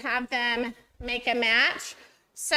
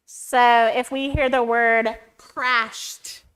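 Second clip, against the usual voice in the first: crashed, slow, loud, with the final t of crashed held out; slow and loud, press the t ending of crashed; exaggerated word endings; 8 dB louder, slower than usual. Shown as a demonstration. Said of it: "that's, okay?".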